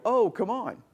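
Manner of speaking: a high, incredulous scoff on Oh; exasperated scoff. Retold or imitated. Scoffing at a friend's trivial complaint, incredulous. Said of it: "and I go".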